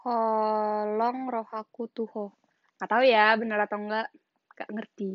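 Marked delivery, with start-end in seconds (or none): 0.0-2.2 Holong roh aku tuho said slowly, each word careful; Batak phrase. Shown as a demonstration. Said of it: "Bahasa Batak aku".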